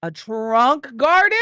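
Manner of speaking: gasping, loud, slow surprise; thrilled surprised question; delighted gasp. Real, not acted. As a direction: gasp at the discovery. Gasp at a find.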